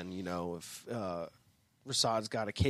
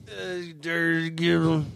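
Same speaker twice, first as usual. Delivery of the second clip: put-on slow slurred drunk answer; 3 semitones higher, 4 dB louder, slower than usual. Shown as a demonstration. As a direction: mumble it slowly, drunk. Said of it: "the drunk voice that we have".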